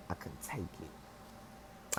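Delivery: slow breathy whisper; barely voiced, drawn out. Real, not acted. Overwhelmed by the smell.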